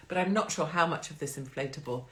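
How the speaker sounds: usual speaking voice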